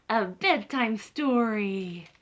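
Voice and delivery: slow, stretched-out bedtime plea; drawn-out plea. Acted. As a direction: savor each word like a wish. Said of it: "Chicken asks for story".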